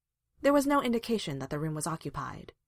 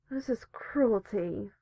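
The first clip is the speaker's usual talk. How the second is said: very slow, higher-pitched groggy complaint; muffled, sleepy protest; 4 semitones higher, much slower than usual. A narrator's reading. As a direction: drag it out as if from under blankets. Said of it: "came a muffled voice from the blanket lump".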